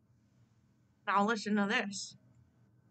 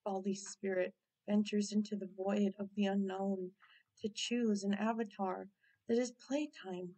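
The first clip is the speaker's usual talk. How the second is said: low, soft narrator voice; dramatic narration; 4 semitones lower, 6 dB quieter than usual. Acted. Narrator announcing the spirit's journey, lower and softer. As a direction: intone it like a mystical narrator.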